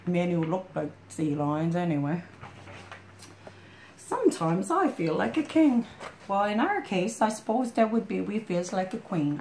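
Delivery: ordinary delivery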